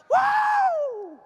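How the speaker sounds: high, loud whoop of joy. Acted. Preacher cheering the congregation, a high joyful whoop. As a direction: let out a soaring celebratory whoop.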